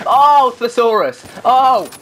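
high, loud, slow "Oh, thesaurus"; hammed-up eureka; excited exclaim. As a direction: cry it out with delight.

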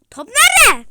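loud, high yell; shout. Real, not acted. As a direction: shout for the ball. Topu arayan bağırış. (topu arayan bağırış)

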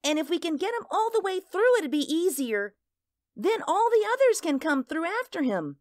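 put-on a small girl earnestly explaining her game; child's voice.

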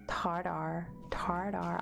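Tartar as TAR-der, American r's; careful American accent try. Shown as a demonstration. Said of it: "can't say tartar in American accent".

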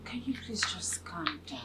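whispered plea. Acted. Pleading to calm angry woman.

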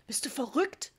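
mildly exasperated dad; snapping. A narrator's reading. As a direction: snap the question at your wife. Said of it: "schnauzte Papa sie an".

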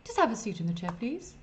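high loud fancy French-ish barber; snooty barber. Acted. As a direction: play the pompous stylist. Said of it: "replies the barber".

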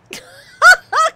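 éclat de rire aigu et fort. Real, not acted. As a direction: laisse éclater un grand rire. Joueuse rit hors personnage. (fou rire aigu)

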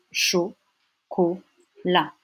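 each syllable on its own, clear gaps between. Shown as a demonstration. Teacher cutting a French word into parts, syllable by syllable. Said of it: "avec des syllabes coupées".

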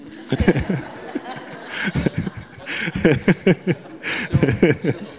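a long airy laugh, mostly breath; long breathy laugh. Real, not acted. Preacher laughing at his own Pretoria joke, long breathy laugh.

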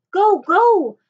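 high, loud cry to hurry; urgent urging. A narrator's reading. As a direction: urge them to run. Urging escape from approaching samurai.

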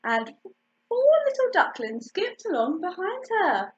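ordinary delivery, nothing marked

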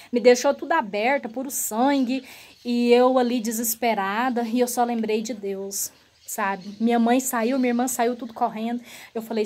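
ordinary talk

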